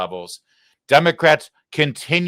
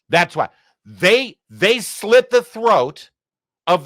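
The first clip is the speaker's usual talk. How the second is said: slow, louder outraged emphasis; 4 semitones higher, 5 dB louder, slower than usual. Real, not acted. Commentator slamming Democrats over snap, slow outrage. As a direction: hammer the accusation slowly. Commentator decrying slashed programs.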